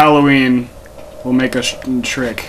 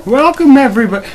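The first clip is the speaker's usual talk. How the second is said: high, loud excited greeting; hyped welcome; 12 semitones higher, 5 dB louder, faster than usual. Real, not acted.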